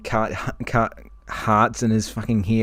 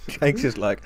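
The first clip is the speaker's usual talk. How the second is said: normal talking voice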